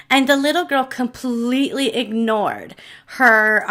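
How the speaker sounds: ordinary talk, nothing marked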